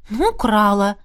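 mild, resigned confession; sighing admission. A narrator's reading. Miranda admits the theft, sighing a little. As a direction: own up with a hint of a sigh. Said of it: "вздохнула она".

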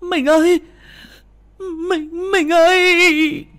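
very high, loud wailing cry; wife wailing over her husband. A narrator's reading. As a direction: wail over your husband. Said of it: "Bà hét lên".

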